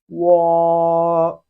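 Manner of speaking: loud, held wo for students to copy; Chinese wo. Shown as a demonstration. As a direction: model wo clearly. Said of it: "Repeat after me".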